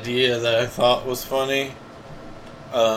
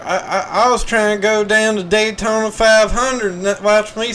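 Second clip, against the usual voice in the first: hammed-up Southern accent; 9 semitones higher, 4 dB louder than usual. NASCAR fan lost at a golf tournament, loud high Southern accent. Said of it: "let me get into character here".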